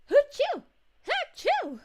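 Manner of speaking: two sharp high sneezes; a real double sneeze; loud sneezes. Real, not acted. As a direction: sneeze loudly twice. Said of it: "I sneezed".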